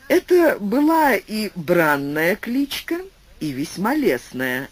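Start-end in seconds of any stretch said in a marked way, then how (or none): none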